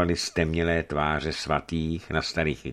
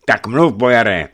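louder, raised, insistent command; stern order; 6 semitones higher, 10 dB louder than usual. A narrator's reading. Archbishop insisting that the hesitant boyar speak, stern. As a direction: press him sternly for an answer. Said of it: "nařídil přísně Eufemii".

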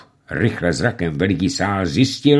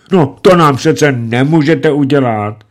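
louder, higher whimper; whining plea; 7 semitones higher, 8 dB louder than usual. A narrator's reading. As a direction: wheedle pitifully. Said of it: "žebronil dále ubohý raketýr". (žebronivě)

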